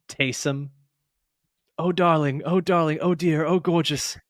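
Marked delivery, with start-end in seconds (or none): 1.8-4.2 high, airy gushing darling tone; Gordon Ramsay gushing. Pretend. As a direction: coo over them like a doting chef. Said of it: "it's the Gordon Ramsay thing".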